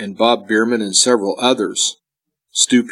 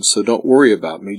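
usual speaking voice

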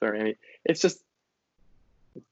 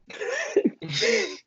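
a burst of high, squealing laughter; high disbelieving laugh. Real, not acted. Telling a friend about an absurd claim, high squealing laugh.

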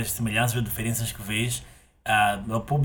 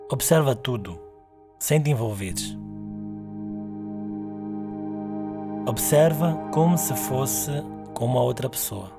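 guided meditation. Acted. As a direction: guide the listener gently into stillness.

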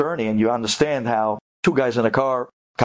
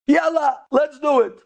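high, eager mock glee; gleeful, as if laughing; 13 semitones higher, 3 dB louder than usual. Taxi driver thinking he made a score, gleeful. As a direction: jump at the bargain, pitched way up. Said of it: "he's laughing his head off".

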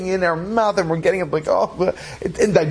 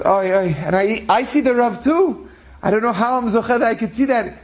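put-on young boy; 6 semitones higher than usual. Young boy owning up that he sees the Rav, lightly voiced child, higher. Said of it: "there was a young boy".

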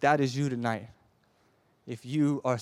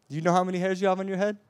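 fast, higher, louder rattled-off question; rapid repeat; 6 semitones higher, 4 dB louder, much faster than usual. Acted. Preacher repeating the question, fast and raised. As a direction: fire it off quickly.

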